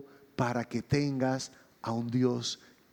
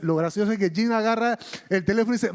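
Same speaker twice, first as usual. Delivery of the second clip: normal talking voice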